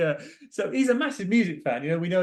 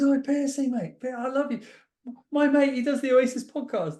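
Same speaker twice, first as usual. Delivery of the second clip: put-on gushing drunk fan; 9 semitones higher than usual. Drunk mate gushing at Stuart Pearce, high and hammed-up. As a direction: ham up a tipsy fan fawning over a hero.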